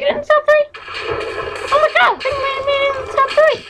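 very high and fast excited. Real, not acted. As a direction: gush with sudden joy. Learns he placed top three.

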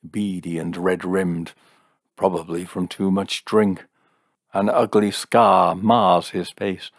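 loud, portentous crystal-gazer; theatrical mystic describing a villain; fortune-telling seer. Acted. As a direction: proclaim an ominous vision with heavy emphasis.

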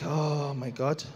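low, drawn-out hushed 'oh my God'; softly appalled and slow; dismayed. Retold or imitated. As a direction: sink into quiet, slow dread. Retells his dread at seeing it.

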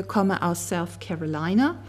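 ordinary delivery

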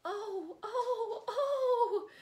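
high, loud laughing yelps; yelled. A narrator's reading. Johnny Lion yelling to mother in mock pain, high and loud. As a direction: yelp it out high. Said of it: "yelled Johnny Lion".

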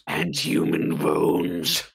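very deep, slow witch voice; scary witch voice. Acted. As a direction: growl it slow like an old crone. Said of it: "stop the scary voice now".

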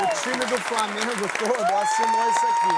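high, loud showing-off; excited presentation. Real, not acted. Host unveiling the signed shirt, high excited voice. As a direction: hype the gift.

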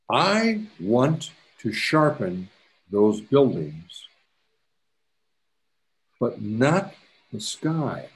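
very slow, spaced-out instruction; taking time. Real, not acted. Instructor walks class through sharpening buildings, very slowly and deliberately. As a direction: draw each phrase out with pauses so learners can follow. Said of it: "I'm gonna take my time".

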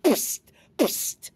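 two sharp voiceless spits; spitting. A narrator's reading. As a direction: spit it out like a lizard. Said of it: "he spat".

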